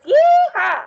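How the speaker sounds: high, loud cowgirl whoop; loud cowgirl holler to finish the loud count; cowgirl yeehaw. Acted. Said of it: "it's the cowgirl fours".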